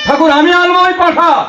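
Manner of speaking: high, held-up acted pledge; urgent devotee's vow. Preacher voicing devotee offering Thakur a rescue, high urgent vow. Devotee volunteers to save the goat.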